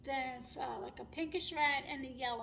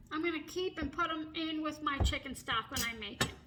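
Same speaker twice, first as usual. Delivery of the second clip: ordinary delivery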